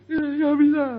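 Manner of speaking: put-on high, whiny child on the verge of tears; crying child. Child failing to lift, high tearful whine. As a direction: play a small kid whimpering that it can't.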